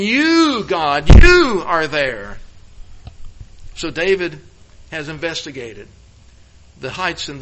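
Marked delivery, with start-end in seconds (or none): 0.0-2.4 preacher shouting You to show the Hebrew emphasis; shouted out. Shown as a demonstration. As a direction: shout the word You out to make the point. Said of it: "you have to shout it out".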